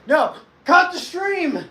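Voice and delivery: high, loud, drawn-out yell; hammed-up high wail; mock panicked shout. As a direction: cry out slowly in mock alarm. Mock panic over the stream.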